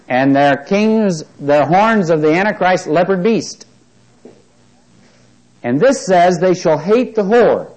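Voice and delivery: a preacher driving prophecy home to the congregation; preaching at full force. Acted.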